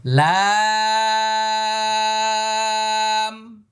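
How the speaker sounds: লাম held very long and high, lips closing on m; slow tajweed demo of লাম; letter name drawn out. Shown as a demonstration. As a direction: stretch লাম on one note. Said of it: "আবার".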